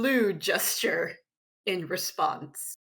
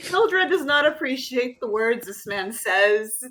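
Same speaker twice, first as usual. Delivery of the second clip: laughing while she speaks; 3 semitones higher, 7 dB louder than usual. Real, not acted. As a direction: giggle through the words. Player laughs through the line.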